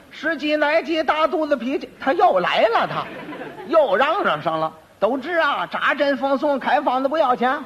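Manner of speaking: a high, sing-song quack doctor's pitch; hawker's cry. Retold or imitated. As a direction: call out the cure like a street seller. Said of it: "我吆喝吆喝". (江湖郎中高声吆喝)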